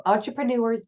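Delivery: ordinary talk, nothing marked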